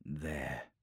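low, soft single word; dropped, quiet voice; hushed discovery. Acted. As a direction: murmur it as you locate it. Psyker finds what he sought.

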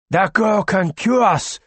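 urgent insistence. Acted. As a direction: press it home. Joker insists.

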